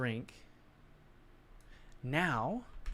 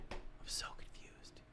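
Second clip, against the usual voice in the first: whisper; 12 dB quieter than usual. Real, not acted. Confused by rotation again.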